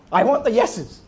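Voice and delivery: hammed-up insistence; mock demanding prayer. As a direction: demand it like a spoiled petitioner. Acted demanding prayer to God.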